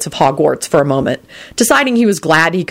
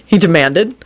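ordinary delivery, nothing marked

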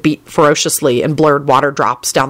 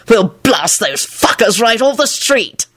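higher, slow smug boast; 5 semitones higher, slower than usual. A narrator's reading. Boasting of beating the officers, smug. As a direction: draw out the cocky threat. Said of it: "Lupin replied smugly".

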